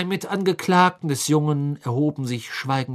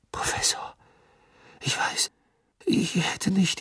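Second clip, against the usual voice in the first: verlegenes Flüstern; 8 semitones higher than usual. A narrator's reading. Harry ertappt, stammelt Entschuldigung.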